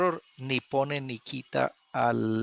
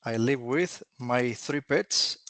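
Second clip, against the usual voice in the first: slow model answer; slower than usual. Shown as a demonstration. Teacher models the full answer.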